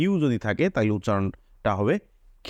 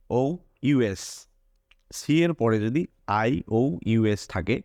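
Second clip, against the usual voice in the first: usual speaking voice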